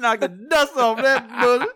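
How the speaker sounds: high, loud, delighted repeat; an excited cackle of disbelief; gleeful echo. Real, not acted. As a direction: crow it back in delight. Gleeful echo of friend's line.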